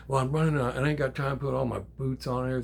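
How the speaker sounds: usual speaking voice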